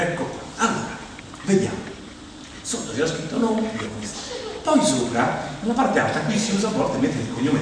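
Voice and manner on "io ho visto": ordinary talk, nothing marked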